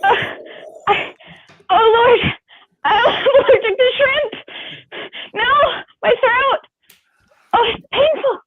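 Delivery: high, strained comic pain; mock allergic agony. Actor playing a shrimp-allergy victim, high strained mock pain. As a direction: whimper through a swelling throat, hammed up. Character has allergic reaction.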